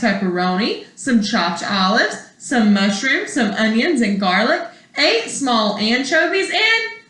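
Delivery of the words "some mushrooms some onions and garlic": plain reading voice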